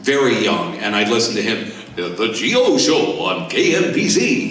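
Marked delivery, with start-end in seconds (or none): 1.9-4.5 slow, rich radio announcer voice; slow booming radio jingle read; Gary Owens impression. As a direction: roll it out like a DJ naming his show. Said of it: "He sounded like that".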